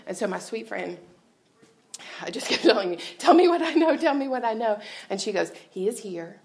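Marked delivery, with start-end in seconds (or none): 3.2-4.8 urgent retold plea, starting loud and high, easing off; desperate pleading. Speaker re-enacts calling her best friend, desperate pleading. 5.7-6.4 soft, steady imitation of a comforting friend; calm reassurance. Best friend on the phone calming her, soft reassurance.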